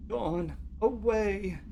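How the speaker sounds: high, slow, lost woman's voice; a scared caller drifting away; frightened caller. Acted. Caller in transcript read aloud.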